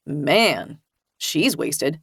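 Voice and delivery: a cop muttering dryly; male officer voice. A narrator's reading.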